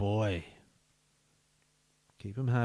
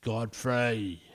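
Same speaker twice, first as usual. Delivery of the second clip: ordinary talk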